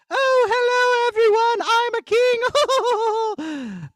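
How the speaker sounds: very high, slow put-on king voice; trial king voice. Shown as a demonstration. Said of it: "is this puppet going to sound like this?".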